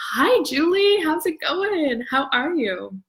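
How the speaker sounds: high, sing-song morning hello; cheery greeting. Retold or imitated. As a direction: chirp hello like a bright morning. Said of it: "and I would say".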